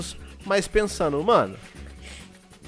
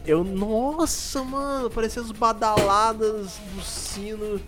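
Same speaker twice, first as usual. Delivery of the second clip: dazed and slow; 6 semitones higher, much slower than usual. Retold or imitated. Retelling the dizzy loló rush.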